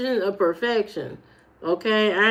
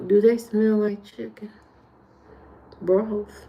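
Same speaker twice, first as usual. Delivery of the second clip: usual speaking voice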